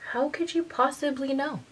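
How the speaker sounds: high, soft, disbelieving question; hushed astonishment; amazed. Retold or imitated. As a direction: ask it like you're stunned she knew. Amazed at mom.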